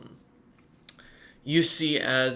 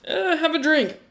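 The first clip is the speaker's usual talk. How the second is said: much higher, cheery offer; friendly barkeep; 4 dB louder than usual. Acted. Ice welcomes the adventurer with a drink, high and cheery. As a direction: play the jolly barman pouring ale.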